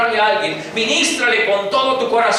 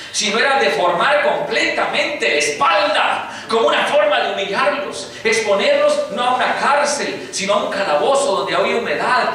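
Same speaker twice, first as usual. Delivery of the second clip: higher, insistent pulpit voice; full preacher's fervor; impassioned preaching; 4 semitones higher than usual. Acted. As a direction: drive home Paul's suffering.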